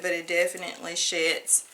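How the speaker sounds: usual speaking voice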